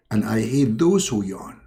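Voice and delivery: low secretive divine voice; Allah voice. Acted. God revealing secret.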